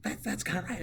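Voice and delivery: high, hesitant put-on voice; next year's teacher. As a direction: stammer agreement as an imagined teacher. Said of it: "whoever the new social studies teacher is".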